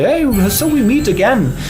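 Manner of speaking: put-on high, loud old-fashioned leprechaun voice; ancient-sounding leprechaun. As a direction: greet like a quaint old sprite. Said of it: "he talks in this very ancient way".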